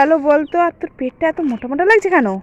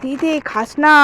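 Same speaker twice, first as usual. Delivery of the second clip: ordinary talk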